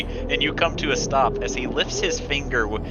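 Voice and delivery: ordinary delivery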